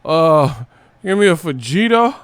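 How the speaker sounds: high, loud, slow goofy voice; mock clueless customer. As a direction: ham up the dude ordering a quesadilla. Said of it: "he was like".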